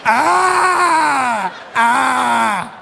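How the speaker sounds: long, held bleating cries; imitated oblivious laugh. Son laughing under headphones, imitated droning blare. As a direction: blare it like someone deaf to his own laugh. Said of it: "This is what I hear".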